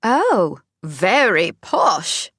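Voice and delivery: slow, hammed-up posh English accent; mock his London English accent badly; terrible English accent. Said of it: "in a terrible version of my accent".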